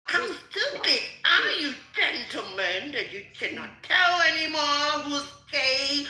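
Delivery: loud, high indignant outburst; angry rant. Real, not acted. Scolding the gentlemen who mock her, loud and high. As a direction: berate them at full voice. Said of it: "Like this voice".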